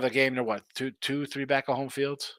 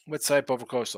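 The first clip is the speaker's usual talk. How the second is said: ordinary talk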